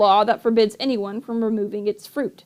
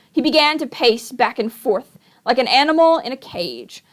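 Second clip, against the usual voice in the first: usual speaking voice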